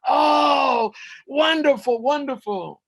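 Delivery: loud, high rapture, easing off; rejoicing. A narrator's reading. Elders tasting the special sauce, rejoicing. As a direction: revel in it, starting big. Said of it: "and they rejoice".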